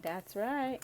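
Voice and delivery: smug, laughing and rising at the end. Retold or imitated. Imitating the Capricorn's cocky reply, smug and laughing. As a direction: own it, cocky and pleased. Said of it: "And you're like".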